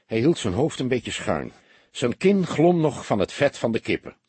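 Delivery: usual speaking voice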